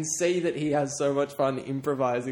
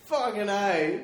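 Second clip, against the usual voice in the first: excited cheer; 8 semitones higher, slower than usual. Real, not acted. Cheering the winner.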